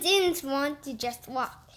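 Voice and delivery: ordinary delivery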